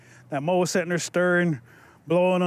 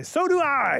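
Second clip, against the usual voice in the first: lightly voiced little creature, pitched higher; 7 semitones higher, slower than usual. A narrator's reading. Little mole interrupting the crying young man, lightly voiced little voice. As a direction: pipe up in a higher, small voice. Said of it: "he hears a little voice say".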